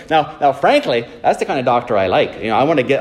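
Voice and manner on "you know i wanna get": usual speaking voice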